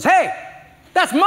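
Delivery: put-on high, loud little girl's claim; child's protest. Granddaughter guarding her McNuggets from Papa, high indignant claim. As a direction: snap it back like a possessive kid.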